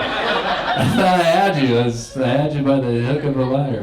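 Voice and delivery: chuckling sorry to the crowd; laughing apology. Real, not acted. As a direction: laugh through the excuse.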